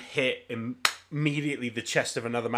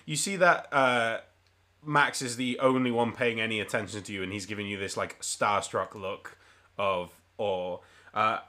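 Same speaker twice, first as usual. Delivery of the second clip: normal talking voice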